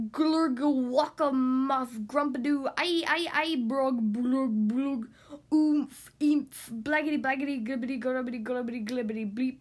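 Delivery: loud nonsense gurgles; gurgling alien voice. Acted. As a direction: blurt silly alien gibberish. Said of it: "make silly sounds".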